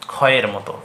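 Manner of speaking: ক্ষয়ের said as khoy, clear k-kh sound; pronunciation demo. Shown as a demonstration. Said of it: "না ক্ষিয় হবে শুধু". (ক্ষয়ের উচ্চারণ দেখানো)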